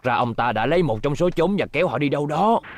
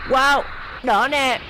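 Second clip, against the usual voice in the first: high, bright-eyed exclamation; excited; 8 semitones higher, much slower than usual. Acted. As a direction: gasp with childlike delight. Child excited at discovery. (reo lên phấn khích)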